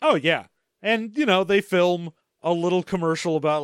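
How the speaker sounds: ordinary delivery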